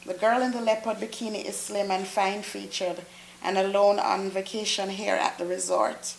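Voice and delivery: usual speaking voice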